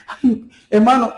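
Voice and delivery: high, loud, slow cry of worry; the frightened pastor's plea again; a hammed-up anxious wail; mock panic. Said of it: "lo llamó temblando".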